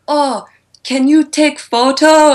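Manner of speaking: high, loud, slow foreigner-directed English; mock pidgin English. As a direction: imitate talking down in slow broken English. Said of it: "We're all slightly racist".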